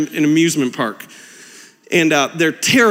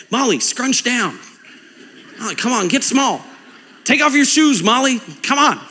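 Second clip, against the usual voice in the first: lightly voiced kids coaxing, higher pitch; urgent kids' pleading; 6 semitones higher than usual. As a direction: play sisters egging on the little one. Sisters urging little Molly, acted.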